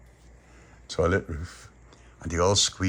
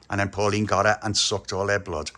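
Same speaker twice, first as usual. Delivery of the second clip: plain reading voice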